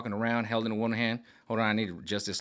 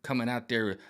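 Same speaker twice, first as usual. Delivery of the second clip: ordinary delivery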